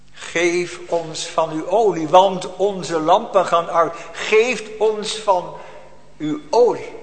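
slow, drawn-out plea for oil; slow pleading. Acted. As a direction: stretch each word like a begging cry. Foolish virgins pleading. (langzaam smekend)

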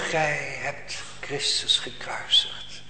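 whispered slowly, almost no voice; a hushed, slow whispered verdict. Acted. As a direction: breathe the accusation in a slow whisper. Peter's sharp word to crowd.

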